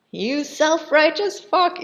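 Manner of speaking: high, loud, drawn-out insult; mock yelled obscenity. Tortured Marquis cursing his captor, mock yelling. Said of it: "he's just yelling obscenities".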